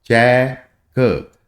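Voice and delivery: चेक said very slowly with the vowel held long; long vowel demo. Shown as a demonstration. Teacher showing the long sound in चेक. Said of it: "sound लंबी जा रही है".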